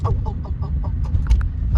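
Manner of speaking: breathless whispered oh oh oh; panicked under-the-breath gasps; nervous whisper. Real, not acted. Vlogger spotting the police next to her car, whispered panic.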